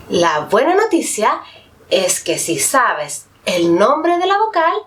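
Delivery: usual speaking voice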